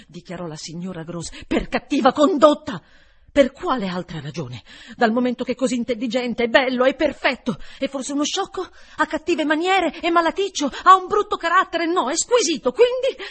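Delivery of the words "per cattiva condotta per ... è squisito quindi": higher and louder, climbing to a peak; indignant outburst. A narrator's reading. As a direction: defend the boy in rising indignation. (sfogo indignato che sale sempre più acuto e forte)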